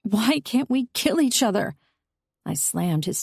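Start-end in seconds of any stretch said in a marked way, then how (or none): 0.0-1.8 anguished cry. A narrator's reading. Heroine breaks down crying.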